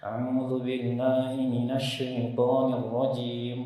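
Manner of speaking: slow, soft, slurred chant; imitating a lazy student reciting; lazy recitation. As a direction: drone it out half-asleep. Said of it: "kayak orang males-malesan".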